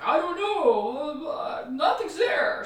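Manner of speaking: high, loud, slow helpless whine; whiny husband. Retold or imitated. Husband on the jail phone, helpless and whining. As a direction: drag it out like a lost man. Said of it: "And he's just like".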